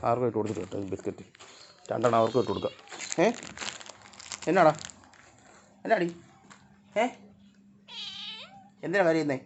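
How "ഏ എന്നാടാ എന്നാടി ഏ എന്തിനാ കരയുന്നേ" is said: high, cooing pet talk; coaxing a crying dog. Real, not acted. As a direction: soothe the whining puppy, pitch way up. Asking crying dog why.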